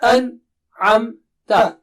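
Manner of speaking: An-amta, very slow, syllables apart; slow, spaced syllables; slow, split syllables. Shown as a demonstration. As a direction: stretch An-amta with a gap between parts. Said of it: "read them separately".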